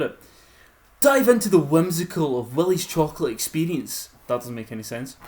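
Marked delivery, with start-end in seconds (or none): 1.0-4.1 louder, grand mock advert voice; mock ad read. Reading the website aloud to friends, mock grand. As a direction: sell it like a cheesy advert.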